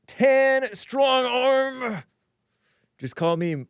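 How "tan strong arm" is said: high, drawn-out tan strong arm; hammed-up, stretched high-pitched readout; playful emoji readout. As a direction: sing out each word high and long.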